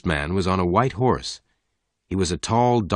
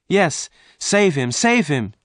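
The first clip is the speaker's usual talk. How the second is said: pleading; 10 semitones higher, 3 dB louder, slower than usual. A narrator's reading. Jane begging the stranger.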